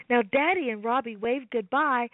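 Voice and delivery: ordinary delivery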